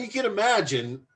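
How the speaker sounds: normal talking voice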